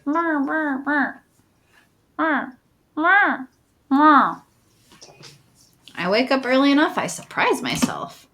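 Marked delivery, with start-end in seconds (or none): 0.1-4.4 high, short cat mews; cat meows. Acted. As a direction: mew repeatedly like a cat. Voices cat beside a sleeper.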